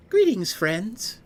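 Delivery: glassy, tinny watery voice; thin voice no bass. Acted. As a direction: speak thin and tinny, slow. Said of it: "glassy, tinny voice".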